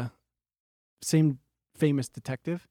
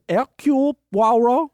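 slow, high, loud French pronunciation; French accent; 7 semitones higher, 6 dB louder, slower than usual. Retold or imitated. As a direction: draw out the name like a French detective. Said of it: "He says".

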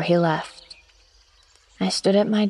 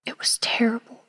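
a hushed, horrified whisper; 4 semitones higher, 4 dB quieter than usual. Acted. Helen recalling the terrible night, whispered. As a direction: let the horror fall to a whisper. Diarist overcome recalling the storm.

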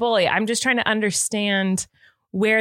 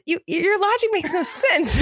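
laughing disbelief; 7 semitones higher than usual. Real, not acted. Laughing at his reasoning.

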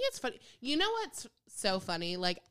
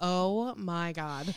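dismayed; 4 semitones lower, much slower than usual. Retold or imitated. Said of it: "I was like".

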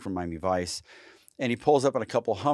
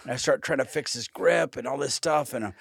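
ordinary talk

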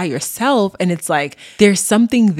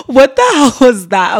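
amused disbelief; 7 semitones higher, 8 dB louder than usual. Real, not acted. Reacting to her own voice.

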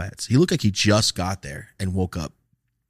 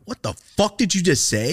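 raised, louder retold outrage; indignant challenge; 10 semitones higher, 6 dB louder than usual. Student answers officer calling him a puncher, indignant. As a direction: challenge him, acting affronted. Retort to accusing cop.

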